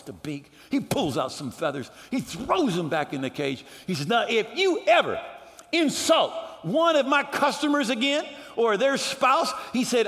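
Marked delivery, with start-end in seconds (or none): 4.1-9.5 raised-pitch, emphatic scolding; hammed-up angry ultimatum; threatening manager. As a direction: lay down a stern warning. Acted manager threatens the parrot.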